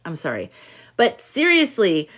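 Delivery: normal talking voice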